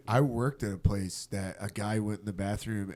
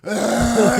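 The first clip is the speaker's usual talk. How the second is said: high, loud strangled scream; choking scream; 11 semitones higher, 10 dB louder than usual. Retold or imitated. Son wakes his dad while choking on ice, choking scream.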